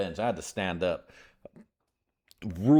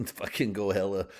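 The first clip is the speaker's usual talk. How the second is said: ordinary talk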